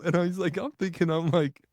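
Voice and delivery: ordinary talk, nothing marked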